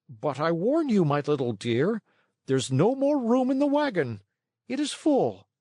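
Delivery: high, sugary wheedle; coaxing fat man voice. A narrator's reading. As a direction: tease him sweetly.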